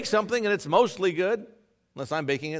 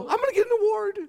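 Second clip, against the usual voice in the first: much higher, mock-hopeful squeak; mock naive optimism; 4 dB louder, faster than usual. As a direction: play the clueless optimist.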